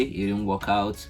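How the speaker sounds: ordinary talk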